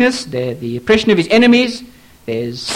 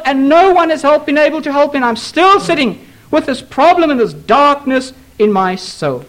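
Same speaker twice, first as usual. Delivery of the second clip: higher, pleading voice of a worn-out believer; despairing complainer; 9 semitones higher than usual. Retold or imitated. Preacher voicing a hopeless church member, higher pleading. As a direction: plead that nothing has helped, voice lifted high. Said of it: "someone will say to me".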